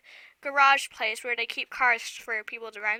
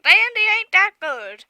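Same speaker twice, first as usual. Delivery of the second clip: put-on Southern accent; 6 semitones higher, 5 dB louder than usual. Catching herself slipping into a mock Southern accent. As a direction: toss off a playful Southern accent. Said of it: "why am I talking like Southern".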